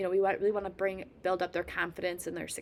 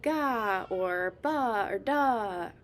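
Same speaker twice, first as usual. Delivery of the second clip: each syllable drawn out slowly and clearly; slow sound demo; 3 dB louder, much slower than usual. Shown as a demonstration. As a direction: model "ga", "ba", "da" slowly for a baby. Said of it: "slowly add some other ones".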